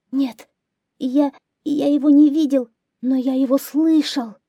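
high, stammering little hare; frightened hare. A narrator's reading. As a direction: plead your case as a scared hare. Frightened hare answers lion. (испуганный заяц, высоким голосом)

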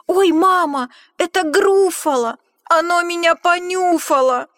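loud, high panicked yelp; terrified cry. Acted. Mouse squealing in terror as the Gruffalo sniffs him. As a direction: squeal in sheer fright.

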